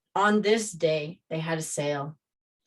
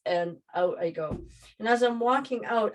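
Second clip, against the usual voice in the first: ordinary delivery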